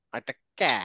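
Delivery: flat front kya, tongue pressed up; ক্যা. Shown as a demonstration. Teacher contrasting ক্যা with ক for students. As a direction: say ক্যা with the tongue pushing up and back. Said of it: "এইটাই difference".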